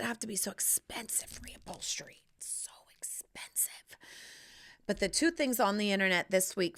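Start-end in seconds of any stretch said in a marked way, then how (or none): 0.0-4.0 whisper. Real, not acted. Whispered complaint about cost.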